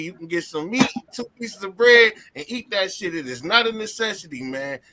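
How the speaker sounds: ordinary talk, nothing marked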